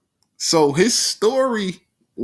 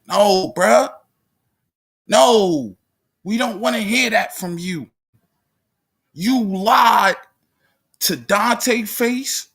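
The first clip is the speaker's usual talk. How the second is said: angry scolding; 6 semitones higher than usual. Real, not acted. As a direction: scold him like he betrayed you. Scolding Mark for lying.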